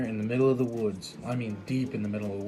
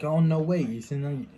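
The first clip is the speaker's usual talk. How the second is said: surprised greeting; 4 semitones higher, 4 dB louder than usual. Retold or imitated. Surprised to see old friend.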